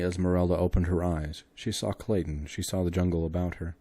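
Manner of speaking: ordinary delivery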